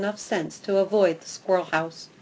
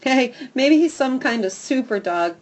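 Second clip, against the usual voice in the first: sneering; 5 semitones higher, 6 dB louder than usual. Acted. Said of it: "with a sneer".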